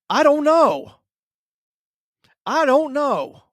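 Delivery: high, loud, drawn-out I don't know; mock client reply. Client stalling the trainer, mimicked high and loud. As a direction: whine it like a sulky cornered client.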